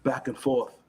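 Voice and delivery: Australian wildlife host; Australian accent. Acted. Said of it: "my best Australian accent".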